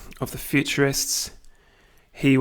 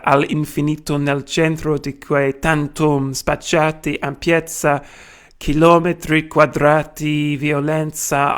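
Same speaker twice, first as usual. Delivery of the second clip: put-on Italian read in a broad British accent; 4 dB louder than usual. Lecturer reading Marinetti in Italian for students, British accent. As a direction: read the Italian like a hungry Brit. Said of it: "in my British accent".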